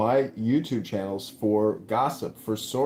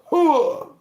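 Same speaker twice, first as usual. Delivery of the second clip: gung-ho soldier; 9 dB louder than usual. Acted. Army veteran campaign rally cry.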